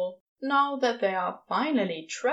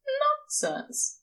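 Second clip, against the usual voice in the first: higher, firm brush-off. Acted. Angel dismisses Crowley's modesty, firm and higher. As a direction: shut the idea down kindly but firmly. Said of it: "says Aziraphale firmly".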